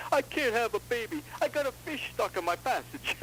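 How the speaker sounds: high, plaintive joke voice; mock crying wife. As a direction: play the distraught wife in a high, tearful whine. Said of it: "she's crying".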